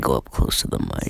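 low breathy voice right on the mic; deep close-mic voice. Pretend.

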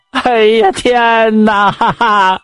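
high, slow crying wail; wailing lament. Acted. Neighbor's wife wailing over her lost sow, high drawn-out sob. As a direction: drag the words out in a sobbing howl. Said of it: "媳妇是连哭带嚎".

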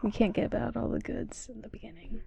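soft unvoiced whisper. Real, not acted. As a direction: whisper it like a secret. Teasing withheld vape ASMR.

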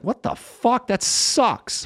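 high-pitched, indignant disbelief; retold dismay. Comic recalling news of a star's guest spot, indignant. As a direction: protest it, jumping up in pitch.

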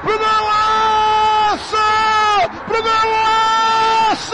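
long drawn-out goal scream; explosive goal commentary. Real, not acted. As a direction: stretch every golaço at full voice. Goal call on radio.

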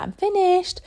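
put-on a two-year-old telling mum he's done, high and proud; proud toddler. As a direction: announce it like a proud little boy. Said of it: "he's like".

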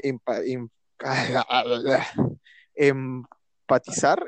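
very slow, stretched out, unsure, rising; groping for the word. Real, not acted. Searching for a word in front of a friend, slow and unsure. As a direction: drag out empatizar as if unsure how it goes. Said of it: "¿cómo es esa hueva?".

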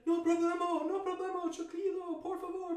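very high, frantic begging; panicked pleading, retold. Campers begging screaming attackers, high retold pleading. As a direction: plead in a squealing mock panic.

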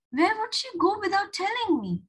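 high-pitched bewildered question; wondering aloud. A narrator's reading. Julia finding April gone, bewildered wondering. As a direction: puzzle aloud in rising disbelief. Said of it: "wondered Julia".

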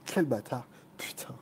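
breathy whispered swear; whispered curse. Real, not acted. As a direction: mutter it with no voice. Cursing under breath. (juron chuchoté)

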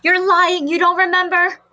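put-on high, loud accusing children's voice; children crying out. A narrator's reading. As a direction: shout it like a pack of jeering kids. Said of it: "cried the other children".

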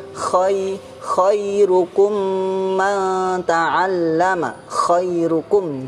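slow chanted khairukum man ta'allama, scraped kh; correct kho recitation. Shown as a demonstration. Teacher modelling kho, slow raspy khairukum man ta'allama. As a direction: stretch khairukum man ta'allama with raspy kh and long nasal hum. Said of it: "tadi yang kho juga".

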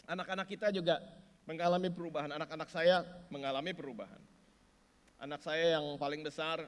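loud, driving preacher's proclamation; preaching at full force. Acted. Pastor to the congregation, preaching at full force. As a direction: press each phrase home to the congregation.

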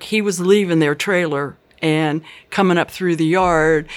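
normal talking voice

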